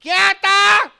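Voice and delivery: high screeching mock-wife shout; loud, high-pitched accusing yell; wife's fiery tone. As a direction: erupt like lava, hammed-up. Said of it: "લાવા શરીરમાં ઉપડ્યો હોય એવો અવાજ".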